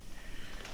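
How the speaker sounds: soft, breathy exhale; real winded sigh; tired sigh. Real, not acted. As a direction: blow out a quiet breath of exertion. Worn out from sanding.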